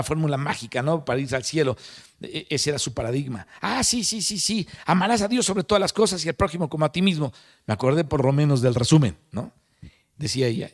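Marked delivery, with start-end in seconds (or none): 3.6-7.3 eager recitation. Retold or imitated. Doctor reciting formula to angel.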